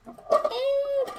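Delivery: high, slow mock voice; a hint of a goofy character; silly voice. Streamer playing a goofy egg voice. Playful game voice.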